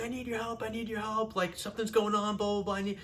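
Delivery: high, pleading mock yell; retold yelling. Brother begging rehearsing sister for help, retold high-pitched yell. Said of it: "So I'm yelling".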